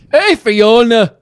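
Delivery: half-hearted ogre voice calling out a name; lightly voiced Shrek. Auditioning for Shrek to her cohost, a loose hint of ogre. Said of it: "here's my audition for Shrek".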